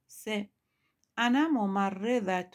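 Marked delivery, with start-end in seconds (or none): 1.2-2.5 louder, careful Arabic reading. Shown as a demonstration. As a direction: read the Arabic sentence out clearly for the class. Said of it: "من پرستار هستم".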